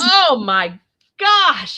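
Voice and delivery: high, very slow, stretched out; mock awe. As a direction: savour it in fake wonder. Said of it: "he goes".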